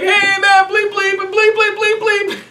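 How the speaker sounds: very high mock holler, sustained; imitated yelling. Group clown to a passing worker, yelling vulgarities. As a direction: call out bleeped curses to a passer-by. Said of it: "this guy's yelling it".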